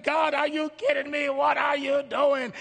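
loud, high, slowed exasperated protest; frustrated. Acted. Believer fed up with God, frustrated outcry. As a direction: hurl the questions at God. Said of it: "you're getting frustrated with life".

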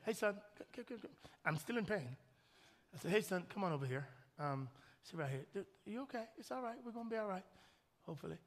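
soft, gentle reassurance to his son; tender comforting. Retold or imitated. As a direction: soothe a sad child. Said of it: "And I said".

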